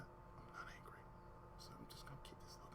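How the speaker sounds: barely audible whisper; whispered denial. Retold or imitated. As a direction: keep it under your breath. Retold answer to Damon, angry-faced.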